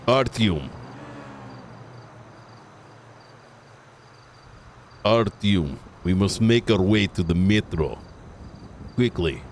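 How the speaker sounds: low Russian-accented voice; deep, earnest Russian accent. Shown as a demonstration. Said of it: "doing Artyom's accent".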